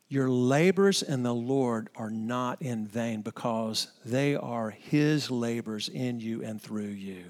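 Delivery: tender reassurance. Acted. Preacher reassures struggling pastors, softly and tenderly. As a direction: lay it gently on weary hearts.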